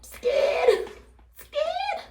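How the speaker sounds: very high, squeaky put-on voice; mock terrified. Vlogger joking about her fear of sizzling, high mock-scared voice. Hammed-up terror, then admits joking.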